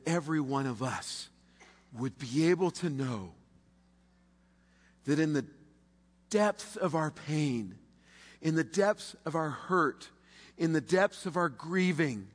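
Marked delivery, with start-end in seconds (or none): none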